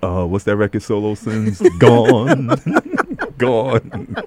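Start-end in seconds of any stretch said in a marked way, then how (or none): 1.8-4.1 laughing. Real, not acted. Gloating over the player's exit.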